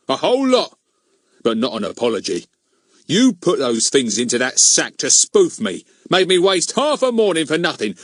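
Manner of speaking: rude Mister Goon figure, scornful; self-righteous scolding, rising in force; gloating, bullying policeman. Acted. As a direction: refuse him with relish.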